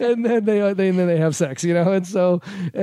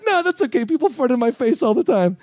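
mock reassuring; 5 semitones higher than usual.

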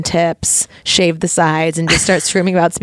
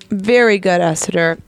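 ordinary talk, nothing marked

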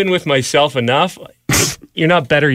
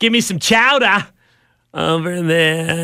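put-on Boston accent; 3 semitones higher than usual. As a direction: ham up the Boston dropped R. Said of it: "Well, I agree. I'd take".

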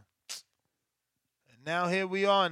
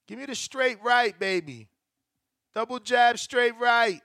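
yelling from the sidelines; 5 semitones higher, 4 dB louder than usual. Real, not acted. Cheering punches for knockout bet.

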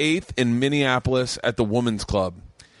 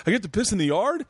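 delighted question, pitched higher; excited; 9 semitones higher, faster than usual. Retold or imitated. Friend thrilled at peeing outside, mock excited. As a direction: perk up like a kid getting a treat. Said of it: "Moshe lit up like".

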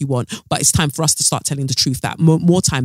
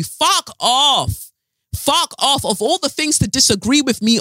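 angry dismissal; 9 semitones higher, slower than usual. Real, not acted. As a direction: fling it at the critics.

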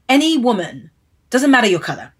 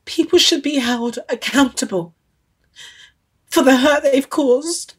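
close to tears; 6 semitones higher than usual. Real, not acted. Telling interviewer of press hurt, near tears. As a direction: hold back tears through the words. Tearful after losing mum, press lies.

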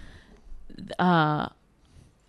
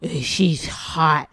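lazy mock dude; 4 semitones lower, much slower than usual. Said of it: "you're like".

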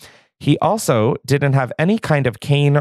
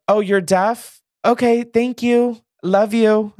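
very high, drawn-out celebrity imitation; Khloe impression; 11 semitones higher, 3 dB louder, slower than usual. Reality star to a deaf passer-by from the car, high mock call-out. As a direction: send it up high, mimicking an oblivious reality star. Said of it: "And Khloe goes".

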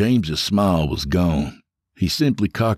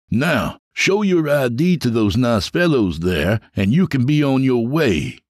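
higher, cocky voice savouring his power; smug James; 6 semitones higher than usual. Acted. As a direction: lord it over him, enjoying every word. James lording over his lobby.

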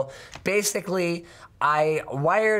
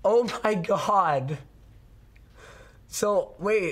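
ordinary talk

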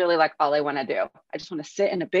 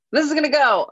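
mock excited; 8 semitones higher, 5 dB louder, faster than usual. Acting hopeful project voice.

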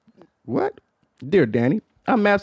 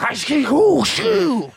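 hammed-up fake German moaning, high; mock German accent; 10 semitones higher, much slower than usual. Imitating a German woman in bed, mock German accent. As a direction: gibberish German moans in a put-on high voice. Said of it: "That was a great German accent".

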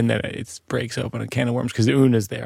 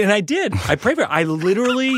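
excited; 10 semitones higher than usual. Real, not acted. Excited admission.

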